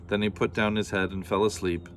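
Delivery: ordinary delivery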